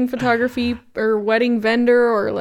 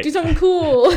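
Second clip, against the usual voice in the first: laughing quote; 7 semitones higher, 4 dB louder than usual. Retold or imitated. DJ retelling, laughing.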